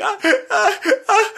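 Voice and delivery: high, loud imitated shrieks of panic; mock screaming. Husband imitating his wife screaming behind the wheel, high mock shrieks. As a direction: shriek like someone who just hit a squirrel. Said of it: "she starts screaming".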